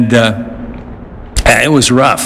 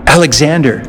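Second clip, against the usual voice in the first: ordinary delivery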